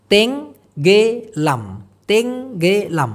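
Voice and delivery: তেঙ্গেলাম drawn out very slowly, syllable by syllable; careful pronunciation demo. Shown as a demonstration. Malay teacher showing students how to say তেঙ্গেলাম, slow and clear. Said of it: "এরকম একটা উচ্চারণ হবে".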